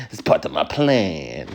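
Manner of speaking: slow, lightly voiced cartoon villain; a hint of a scheming villain, slowed down. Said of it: "villain voice to get him".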